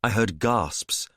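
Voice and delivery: ordinary delivery, nothing marked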